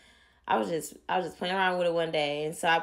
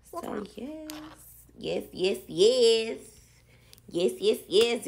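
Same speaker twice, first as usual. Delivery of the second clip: excited yeses; 4 semitones higher than usual. Real, not acted. Hyping up the finished curls.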